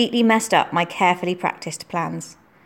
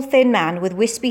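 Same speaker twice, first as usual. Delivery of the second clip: plain reading voice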